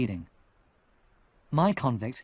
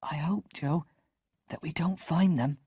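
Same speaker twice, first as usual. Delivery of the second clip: whispered; 3 semitones higher than usual. Acted. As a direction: breathe it into his ear. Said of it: "I whispered in his ear".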